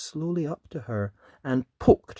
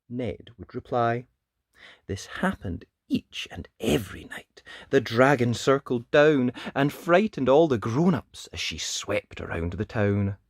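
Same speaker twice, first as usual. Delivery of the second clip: put-on lively read-aloud voice for children; animated picture-book narration; storybook reading; 3 semitones higher, 6 dB louder than usual. A narrator's reading. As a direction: tell it like bedtime story time.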